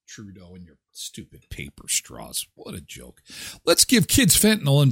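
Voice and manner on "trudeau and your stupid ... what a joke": much softer, lower scornful put-down; low contemptuous aside; scornful mutter. Acted. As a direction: sneer it under your breath. Scornful jab at Trudeau.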